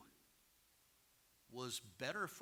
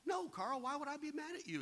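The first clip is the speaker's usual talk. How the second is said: mock innocent reply; 10 semitones higher than usual. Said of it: "I said".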